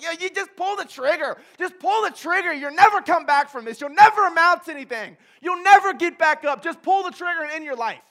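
Satan on his shoulder taunting him; Satan's voice. Retold or imitated. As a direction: sneer and scream it like the devil. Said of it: "I got Satan over here like".

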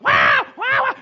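loud mock baby wahs; imitating a wailing toddler. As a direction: bawl like a toddler, hammed up. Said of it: "he popped back up".

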